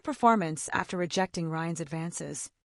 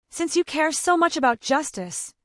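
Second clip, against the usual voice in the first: sneering; 7 semitones higher, 3 dB louder than usual. A narrator's reading. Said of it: "Ryan sneered".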